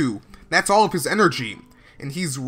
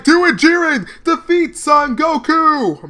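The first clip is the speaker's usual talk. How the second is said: high, loud urging shout; imitated battle-cry urging; voicing a cheering onlooker; 9 semitones higher, 6 dB louder than usual. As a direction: shout it like a desperate command. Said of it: "He's like".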